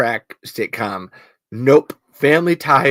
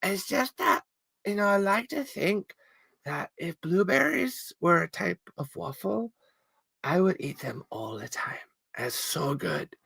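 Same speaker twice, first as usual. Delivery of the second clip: high, much softer dreamy Irish voice; soft Irish accent; 5 semitones higher, 11 dB quieter than usual. Retold or imitated. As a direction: muse dreamily, Irish lilt, almost breathless. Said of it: "I will eat these olives and say".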